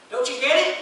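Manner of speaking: teasing taunt. Retold or imitated. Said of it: "you just bust out laughing".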